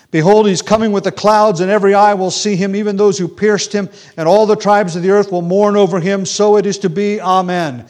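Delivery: loud, high proclamation of scripture; preaching at full force. Acted. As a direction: ring it out like a herald from the pulpit.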